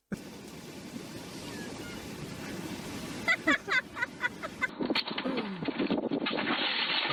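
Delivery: long, airy laughter; breathless silent laugh; wheezing laugh. Real, not acted. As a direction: wheeze through it. Laughing at a clip.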